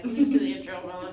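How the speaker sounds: rushed. Real, not acted. Host hurrying to start the intro, fast. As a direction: hurry through it.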